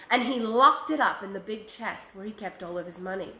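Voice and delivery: usual speaking voice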